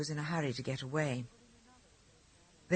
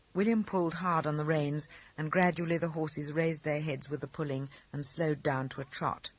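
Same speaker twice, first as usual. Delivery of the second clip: ordinary delivery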